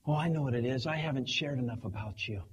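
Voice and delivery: ordinary talk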